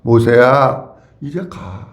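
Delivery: hushed, low-pitched command; God gently sending. Acted. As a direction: speak as God persuading Moses to go. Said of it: "이렇게 말씀하셔요".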